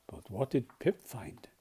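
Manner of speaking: ordinary delivery, nothing marked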